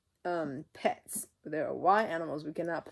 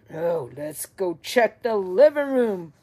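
Papa Bear; 10 dB louder than usual. Acted. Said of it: "said Papa Bear".

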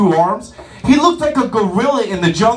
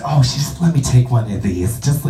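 lower, sheepish excuse-making; apologetic lady; 9 semitones lower than usual. Retold or imitated. Guest apologizing to waiter, lightly voiced sheepish lady. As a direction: mumble apologies while grabbing food. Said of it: "the apologetic sorry lady".

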